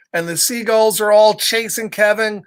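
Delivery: ordinary delivery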